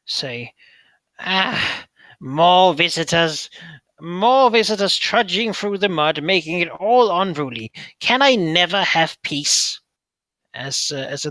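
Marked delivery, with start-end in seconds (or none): 1.2-9.8 loud, slow little mud creature voice; pixie. Acted. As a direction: grumble like a fussy little mud sprite. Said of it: "as you hear a little voice say".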